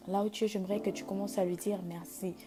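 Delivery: high, loud building sermon; preaching at full force. Acted. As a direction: climb into a fiery altar call. Prédication montante sur musique. (prédication enflammée)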